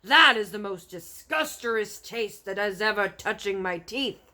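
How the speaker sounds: loud, rough giant ranting; angry giant rant. Acted. Bloodbottler scolding the BFG for eating sludge. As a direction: berate him like a brute.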